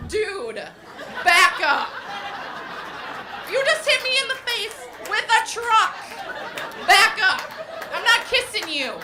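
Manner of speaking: put-on annoyed little kid. Friend fending off the son, loud annoyed kid voice. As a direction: push him off like a cranky toddler. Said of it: "his friend is like".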